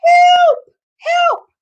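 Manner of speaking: high, loud call for help; cry for help. Acted. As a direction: shout it out from far off, high and loud. Lost deer calling for help.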